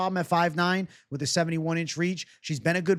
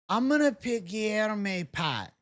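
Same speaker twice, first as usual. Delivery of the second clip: ordinary talk, nothing marked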